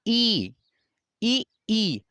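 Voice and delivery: ई stretched out high, tongue up to the palate; drawn-out ई demo. Shown as a demonstration. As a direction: hold ई so students feel the tongue touch the palate. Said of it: "अगर आप बोलेंगे ई".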